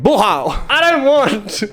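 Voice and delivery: high-pitched mock protest; acted refusal. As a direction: squeal a hammed-up refusal. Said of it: "I'm like".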